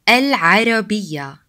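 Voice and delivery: "Al-Arabiya" slowly, with a mid-throat ayn; slow, careful "Al-Arabiya"; ain in Arabic word. Shown as a demonstration. Teacher giving an example word with ain, slow pharyngeal pronunciation. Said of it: "important Arabic words have the ain sound".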